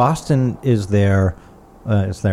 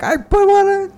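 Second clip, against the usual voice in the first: mock panicked squeal, much higher and louder; freaking out; 3 dB louder, slower than usual. Friend mimics the song's singer freaking out, squealing high. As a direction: imitate the singer losing it over the lights. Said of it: "he freaks out at the end".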